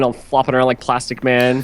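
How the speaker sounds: ordinary delivery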